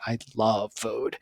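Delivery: dreamy, tender love of food; soft savouring. Shown as a demonstration. Contrasting takes of the same line.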